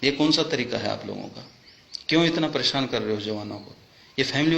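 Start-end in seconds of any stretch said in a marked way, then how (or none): none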